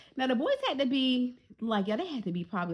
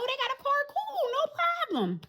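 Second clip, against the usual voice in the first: breezy relief. Retold or imitated. Naive relief at boys' car.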